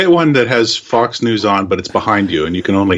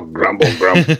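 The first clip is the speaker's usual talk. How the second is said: grumbling TV voice; 4 semitones lower than usual. Retold or imitated. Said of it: "you get the growl".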